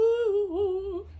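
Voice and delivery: squeaky high ooh; high falsetto ooh. Real, not acted. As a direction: pitch the ooh way up.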